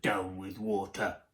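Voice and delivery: low, soft grumble; muttering. A narrator's reading. Tyrannosaurs who couldn't swim, low sulky mutter. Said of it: "they muttered".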